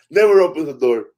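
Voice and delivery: ordinary delivery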